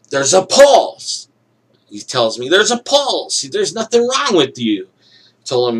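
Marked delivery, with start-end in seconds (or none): 0.1-1.3 high, loud, drawn-out announcement; loud doctor's proclamation. Retold or imitated. Doctor announcing his finding to patient, loud proclamation. 2.5-4.9 high-pitched, insistent retort; emphatic doctor's verdict. Retold or imitated. Doctor dismissing the patient's complaint, emphatic high verdict.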